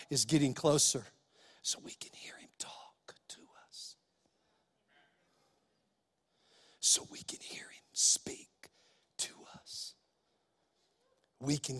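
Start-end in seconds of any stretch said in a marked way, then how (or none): 1.6-9.9 whisper. Shown as a demonstration. Preacher whispers about hearing God.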